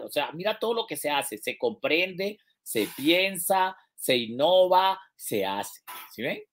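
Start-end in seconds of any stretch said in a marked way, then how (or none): 1.4-5.8 slow emphatic list. Shown as a demonstration. Teacher lists the steps.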